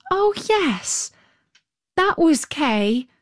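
put-on high, eager little girl; light girlish excitement, lifted high; Gerda's voice. A narrator's reading. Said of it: "said Gerda".

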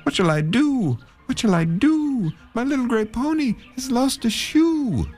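man left in the road lamenting; sighing lament. Acted. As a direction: wail in growing despair. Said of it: "sighing and saying".

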